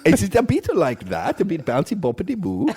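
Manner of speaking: mock Norwegian accent. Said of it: "we've gone to Spain a little bit".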